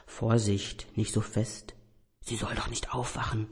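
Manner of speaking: leise, gedämpfte Warnung; geflüstert. Acted. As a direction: leise zischen, damit keiner aufwacht. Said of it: "flüsterte eine Stimme". (Stimme flüstert dem Teppich leise zu)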